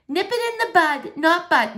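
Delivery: high, pointed know-it-all voice; know-it-all girl correcting the boy's mistake; smug correction. Acted. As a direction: correct him with relish, leaning on butt. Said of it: "she answers".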